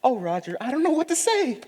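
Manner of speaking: coy lady voice. Acted. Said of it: "pretend that you're Miss Sarah Huffington".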